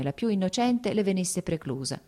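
ordinary delivery, nothing marked

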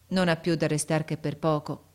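ordinary delivery

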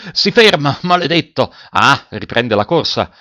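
high, loud commands and curse, easing at the end; urgent shouting. A narrator's reading. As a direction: shout him onward, then ease off.

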